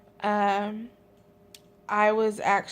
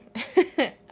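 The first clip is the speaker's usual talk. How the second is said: short laugh; 4 semitones higher, 4 dB louder than usual. Real, not acted. Laughing about eating.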